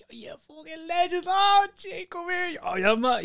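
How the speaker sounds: put-on high, excited greeting of an old mate. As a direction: gush like a thrilled mate in the pub. Mate excitedly greeting him in bar.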